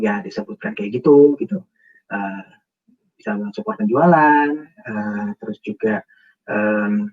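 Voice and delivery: low, halting and listless answer; hesitant, low-energy interviewee; unenthusiastic, slow. Shown as a demonstration. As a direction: answer slowly with no enthusiasm, trailing into ehs. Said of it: "pelan, tidak semangat".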